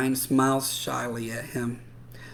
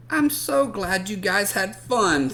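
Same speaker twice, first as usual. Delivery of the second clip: put-on higher, pleased girl's voice; sweet girl voice; 7 semitones higher, 3 dB louder than usual. Girl telling friends she is glad they came, higher voice. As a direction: beam at your friends. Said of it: "says Madison".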